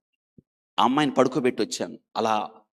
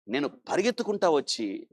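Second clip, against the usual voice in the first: ordinary talk, nothing marked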